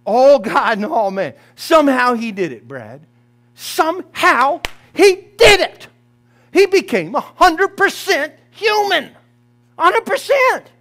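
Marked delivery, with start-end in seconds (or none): 3.6-10.7 fervent preaching. Real, not acted. Preacher climaxes on Christ's nature.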